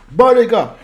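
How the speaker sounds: high, loud call to the chat. Real, not acted. Streamer rallying his chat, loud call. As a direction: grab the viewers' attention.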